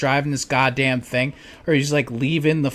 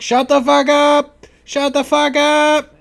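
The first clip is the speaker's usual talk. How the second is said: mock angry yell; 13 semitones higher, 9 dB louder, slower than usual. Retold son yelling at mom.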